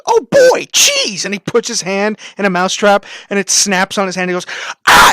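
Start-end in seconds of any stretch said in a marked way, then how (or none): 0.1-1.2 very high, loud cartoonish glee; mimicked gleeful character. Guy on all fours spotting a mousetrap, squeaky excitement. 4.9-5.1 loud, high comic scream of pain; mock scream as the trap snaps; imitated scream. Guy's hand caught in a mousetrap, comic scream. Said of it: "And he screams".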